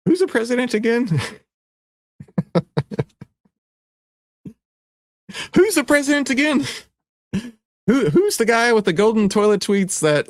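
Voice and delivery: high feigned puzzlement; mock innocent. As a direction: play dumb, teasing.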